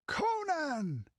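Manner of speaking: high, ringing shout of recognition; excited shout. Acted. Pirate sees Conan racing over the field, shouting. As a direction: call out his name.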